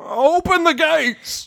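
high, drawn-out order to the gatekeepers; gate command. Acted. Guard ordering the gates opened for cake, high slow call.

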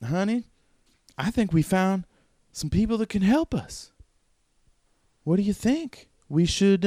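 high, coaxing woman; woman's character voice. Acted. Wife suggesting a fire and food, high put-on voice. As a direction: pitch it high and ask hopefully. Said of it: "she's like".

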